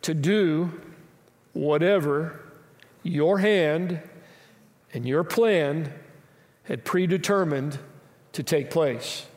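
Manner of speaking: slow, measured reading with long pauses. Shown as a demonstration. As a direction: read each phrase slowly and let it land. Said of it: "Let me read it slowly".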